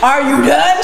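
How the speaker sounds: loud laughing "Are you done?". Retold or imitated. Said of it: "And I said".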